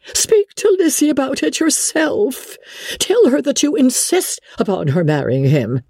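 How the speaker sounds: high, fretful mother's voice; an anxious wife pleading her case, pitched high; Mrs Bennet. Acted. Wife explains the quarrel to husband, high and fretful.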